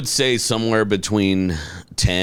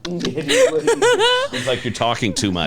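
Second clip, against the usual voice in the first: laughing; 5 semitones higher, slower than usual. Real, not acted. Laughing while explaining.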